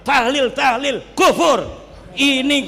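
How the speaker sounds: lampooning a hardliner who condemns everyone, hammed-up shout; mock zealot shouting. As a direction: caricature a fanatic yelling accusations. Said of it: "orang Wahabi ... dia yang menghakimi".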